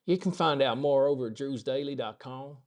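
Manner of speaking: hammed-up American Southern accent; poor Southern accent. Shown as a demonstration. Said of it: "That was a really poor Southern accent".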